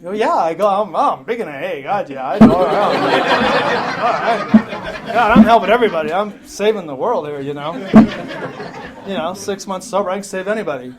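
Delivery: loud, boastful put-on brag; cocky newcomer. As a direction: play the know-it-all who's barely sober. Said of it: "I says".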